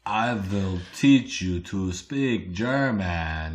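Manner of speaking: slow, soft, menacing German; evil German voice. Retold or imitated. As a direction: drawl it like a sinister villain. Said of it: "more evil".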